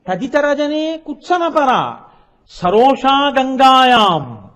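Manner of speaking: slow shloka phrase; intoned quote. Acted. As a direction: chant the line slowly, pressing each word. Requotes verse line.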